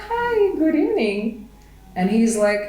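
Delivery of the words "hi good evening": high, surprised greeting. Retold or imitated. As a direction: caught off guard, answer brightly. Surprised reply to stranger.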